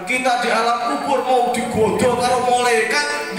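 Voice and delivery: ordinary talk, nothing marked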